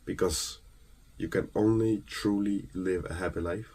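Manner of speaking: normal talking voice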